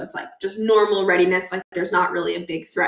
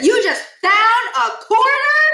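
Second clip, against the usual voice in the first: put-on much higher, louder, slow amazed kid; Stuart amazed; 13 semitones higher, 6 dB louder, slower than usual. Stuart amazed that Miles found a quarter. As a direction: drag it out in wonder.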